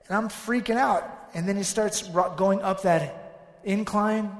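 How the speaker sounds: ordinary delivery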